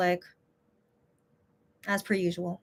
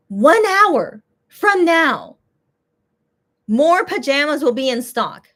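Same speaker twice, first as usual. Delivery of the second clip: slow, higher, louder mock read of "One hour from now"; mocking the tweet; 5 semitones higher, 5 dB louder, slower than usual. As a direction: drag out the tweet sarcastically. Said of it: "So you tweet this saying".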